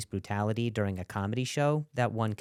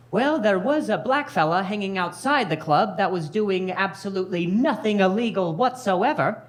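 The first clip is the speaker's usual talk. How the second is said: high, louder put-on cop voice; detective voice; 6 semitones higher, 4 dB louder than usual. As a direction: report it like a smug detective. Detective reports to chief.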